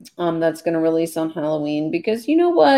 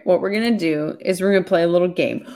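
ordinary talk

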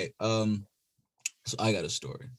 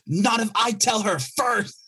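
put-on high, slow cheeky child's comeback; defiant kid; 13 semitones higher, slower than usual. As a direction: snap back like a bratty eight-year-old. Kid's defiant retort.

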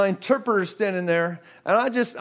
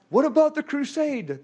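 higher-pitched mock concern; voiced objection, pitched up; retold startled question; 6 semitones higher than usual. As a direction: imitate someone raising a worried objection. Retold startled question about schedule.

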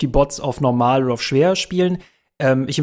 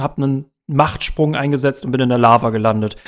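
usual speaking voice

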